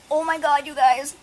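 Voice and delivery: sudden high, loud burst of alarm; jolted by a noise outside, cry out; startled exclamation. Real, not acted. Vlogger startled by a neighbour's lawnmower, loud high alarm.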